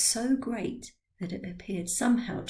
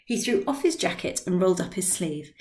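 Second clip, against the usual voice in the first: plain reading voice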